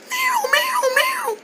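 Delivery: soft, very high wail; bird distress cry. Acted. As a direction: whimper high like a hurt little bird. Hurt bird crying out.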